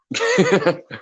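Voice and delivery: short high laugh; real burst of laughter; amused laugh. Real, not acted. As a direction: chuckle high after your own remark. Amused at seeming troll.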